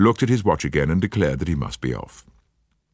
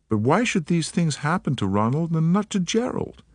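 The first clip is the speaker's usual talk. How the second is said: put-on light American accent, eager and polite; an American woman, gently worried; faint American accent; 9 semitones higher than usual. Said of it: "She spoke with a faint transatlantic accent".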